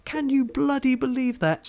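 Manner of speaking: high-pitched outraged cry of disbelief. Retold or imitated. Gamer outraged at the opponent's lucky procs, high disbelief. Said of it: "I'm like".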